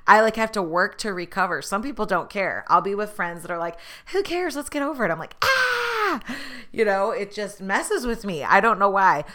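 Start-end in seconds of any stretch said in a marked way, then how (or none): 4.1-5.1 breezy friends. Retold or imitated. Friends shrugging it off. 5.4-6.2 high strained distressed squeal. Retold or imitated. To her friends over an awkward moment: high strained squeal.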